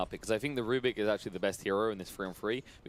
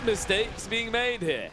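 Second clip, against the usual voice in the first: excited; 12 semitones higher, 5 dB louder, much slower than usual. Real, not acted. Drawn-out hype call.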